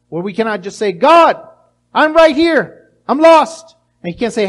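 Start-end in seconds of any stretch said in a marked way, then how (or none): 0.9-3.7 loud, higher cry for help; lost child calling out loud. Pretend. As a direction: call out loud so God hears. Lost person calling to God.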